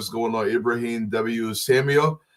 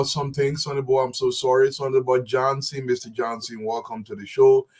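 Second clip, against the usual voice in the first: ordinary talk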